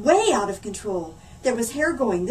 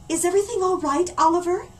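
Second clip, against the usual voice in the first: ordinary delivery, nothing marked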